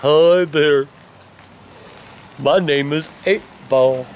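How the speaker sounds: low, slow, lightly voiced greeting from Eight Ball; a hint of a sleepy barfly introducing himself; slow put-on greeting. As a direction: drop the voice and drawl out a friendly hello.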